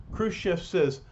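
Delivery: ordinary delivery, nothing marked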